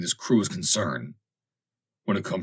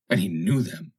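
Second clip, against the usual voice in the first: ordinary delivery, nothing marked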